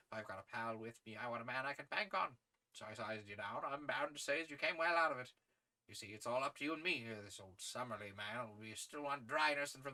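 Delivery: hearty, chatty sportsman in character; English gentleman adventurer. Acted. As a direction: confide in a young recruit with brisk gusto.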